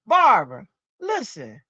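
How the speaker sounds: light Southern drawl, loud on the first Barbara; slow Southern drawl. Retold or imitated. Niece talking to her aunt, in a slow Southern drawl. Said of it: "she's got that slow Southern drawl".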